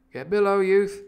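loud, high-pitched command; ordering below. Acted. Captain ordering the cook below.

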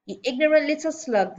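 loud, high furious headmistress; bellowed insult. A narrator's reading. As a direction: hurl the insult at a pupil at full voice. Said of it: "the Trunchbull bellowed".

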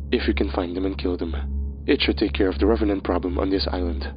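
a hint of a quiet, grave Horseman. Horseman voiced in story.

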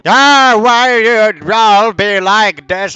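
hammed-up elderly complaint, slow; angry old man. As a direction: wail it like a cranky elder. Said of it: "Elderly Olympics four".

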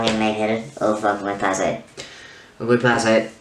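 normal talking voice

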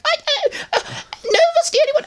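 very high squeaky voice with a laugh; Alpha Centauri voice. Retold or imitated. Alpha answering Omega, very high laughing squeak. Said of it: "I'm both characters".

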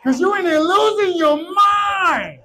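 high, drawn-out shouting; hammed-up frantic high yell; crazy-lady meltdown. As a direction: wail it out like losing control. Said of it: "get away from the crazy lady".

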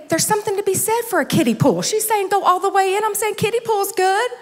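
high, pleading mock-tearful voice; trying not to cry. Speaker pleads the case for staying shallow, comically near tears. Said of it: "trying not to cry".

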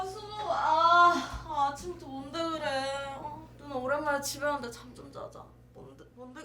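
rudely awakened. Acted. Woken by commotion in morning.

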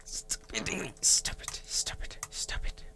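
unvoiced, soft repeated stop it; whispered pleading. Real, not acted. Gamer whispering at a mob hitting him. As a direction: plead under your breath.

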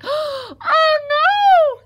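very high, loud squeal of alarm; piglet's scream. Acted. Piglet finds a hungry fox, screaming. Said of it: "screamed the piglet".

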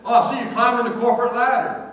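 loud, high, jokey holler; teasing call-out. Retold or imitated. Preacher to worker up on a ladder, teasing call-out. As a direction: call up cheerily to someone overhead.

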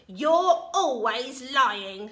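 loud, drawn-out accusing grandma; yelling. A narrator's reading. Grandma accusing George from the roof, yelling. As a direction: yell it slowly, stretching the words. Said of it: "Grandma yelled".

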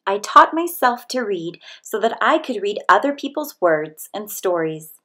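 ordinary delivery

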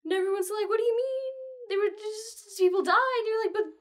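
very high, loud tearful wail; distraught girl wailing between sobs; hysterical crying. Acted. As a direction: sob it all out in a high voice. Hester sobbing hysterically to Anne.

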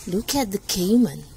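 ordinary delivery, nothing marked

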